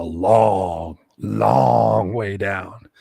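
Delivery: slow, stretched long way down; drawn-out long way. Real, not acted. Telling how far the drop was, slowly drawn out. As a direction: drag it out to show the height. Stressing the height.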